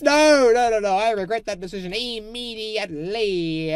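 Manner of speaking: high, loud panicked no no no; panicked yelling. Real, not acted. Builder yells at his own mistake, panicked. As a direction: shout it out in dismay.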